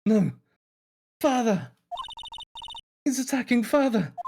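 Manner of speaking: put-on high, frightened child's cry; alarmed child. Child sees father being attacked, alarmed cry.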